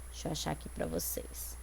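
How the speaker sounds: under the breath while looking for the page; whispered aside while finding the poem. Real, not acted. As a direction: mutter to herself, barely voiced. Teacher searching page, aside.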